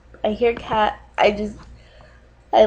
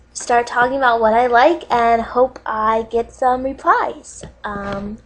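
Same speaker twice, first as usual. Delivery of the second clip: usual speaking voice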